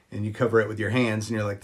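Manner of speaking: ordinary talk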